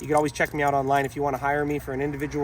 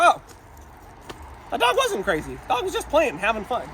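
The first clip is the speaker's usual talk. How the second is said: mildly surprised onlooker, voice rising much higher; relieved surprise; 5 semitones higher than usual. Retold or imitated. Imitating relieved onlooker, higher surprised voice. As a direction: pretend onlooker realizing it was just play. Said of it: "they go".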